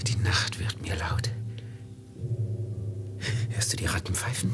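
uneasy whisper into the dark; hushed, as if the night could hear. Acted. Anxious master whispering to his servant Velid about the noises.

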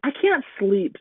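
put-on high child's complaint; plaintive small boy; little boy voice. A narrator's reading. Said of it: "said Jake".

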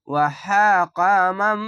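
ওয়া হাকা মাম drawn out, no nasal merging; slow recitation. Shown as a demonstration. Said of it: "ঈদগামে বা গুণ না". (ওয়া হাকা মাম ধীরে, গুন্নাহ ছাড়া)

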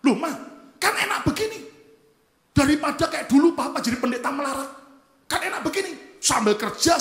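high, loud, heated protest to mother; acted-out angry son justifying himself; angry retort. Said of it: "Saya marah".

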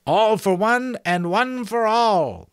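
high, slow, loud thin voice; obliging prompt. Acted. Sentry prompting the forgetful giant, high obliging voice. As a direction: sing out the missing line helpfully, very high. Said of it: "the voice said obligingly".

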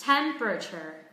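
TEM-per-a-ture, four syllables falling from TEM; first-syllable stress. Shown as a demonstration. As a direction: punch TEM in Temperature, keep the American R. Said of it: "trọng âm rơi vào âm tiết thứ nhất".

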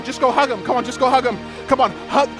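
normal talking voice

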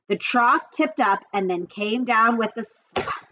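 plain reading voice

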